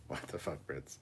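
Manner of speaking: ordinary delivery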